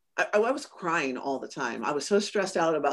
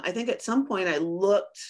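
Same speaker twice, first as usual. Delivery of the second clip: ordinary talk, nothing marked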